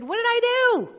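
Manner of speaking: high, loud, wounded what did I do; indignant surprise. Retold or imitated. Wife protesting to her husband in the car, high and indignant. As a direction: protest your innocence. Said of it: "I said".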